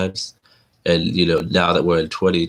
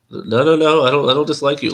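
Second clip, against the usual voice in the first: no no no, pitched up high and louder; flustered high protest; 8 semitones higher, 5 dB louder than usual. Retold or imitated. To a stranger on the bus, a startled high-pitched denial. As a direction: hurriedly insist you mean no offence.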